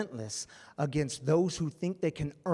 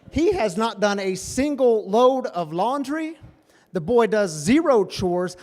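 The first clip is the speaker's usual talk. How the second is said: mock outrage; 5 semitones higher, 10 dB louder than usual. Real, not acted. Preacher to congregation about his napping son, loud comic rant.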